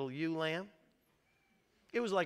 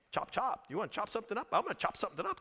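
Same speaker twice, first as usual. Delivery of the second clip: mocking angry king; 5 semitones higher, much faster than usual. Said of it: "David says".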